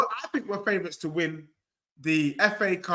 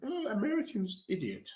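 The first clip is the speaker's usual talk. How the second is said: higher, soft put-on British accent; 4 semitones higher, 9 dB quieter than usual. Shown as a demonstration. Football fan demonstrating his put-on accents, British accent. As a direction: lift the pitch and soften into a British accent. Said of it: "Then with the British accent".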